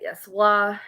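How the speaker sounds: ordinary talk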